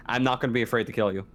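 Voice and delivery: usual speaking voice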